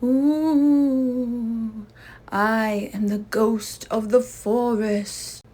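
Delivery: slow, loud, hollow ghost moan. Acted. Papa in a sheet scaring the sleeping Scouts as a ghost. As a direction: boom it out like a ghost.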